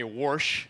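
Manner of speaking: "warsh" with an r, wɔɹʃ; Missouri warsh. Retold or imitated. Missouri folks saying "warsh". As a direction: say "warsh" like they do down there. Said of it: "They say".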